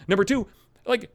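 usual speaking voice

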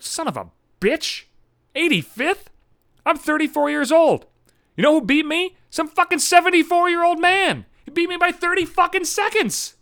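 high-pitched, squawking indignation; incredulous outrage. Acted. Runner reading results, beaten by an older man, incredulous outrage.

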